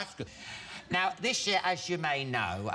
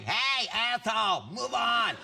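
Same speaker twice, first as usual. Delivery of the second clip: New York tough guy; 5 semitones higher, slower than usual. Retold or imitated. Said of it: "they're like, New York".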